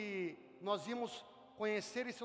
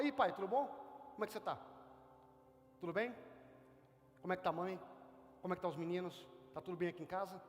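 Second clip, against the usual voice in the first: relaxed, lower and softer, fast at times; casual chat with dad; 7 semitones lower, 6 dB quieter, faster than usual. Retold or imitated. Pastor shows talking to God like to a father, soft and casual. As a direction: talk to God like your own dad, easy and intimate. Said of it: "Você fala".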